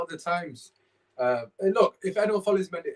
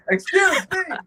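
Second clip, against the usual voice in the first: high, loud mock-indignant cry; playful squawk of protest; mock outrage; 7 dB louder, slower than usual. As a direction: act scandalised, pitched way up. Mock offence during laughing banter.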